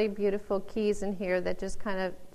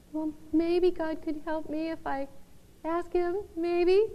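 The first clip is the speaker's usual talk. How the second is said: higher, hesitant, unsure asking; meek and doubtful; 6 semitones higher than usual. Pretend. Preacher mocks a meek half-hearted prayer, hesitant. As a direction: timidly hedge the request. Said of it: "meek and quiet".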